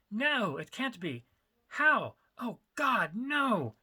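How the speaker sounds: slow then rising to a high, loud panic; the floor drops out from under him; panicked disbelief. Acted. Dealer hears terrible news.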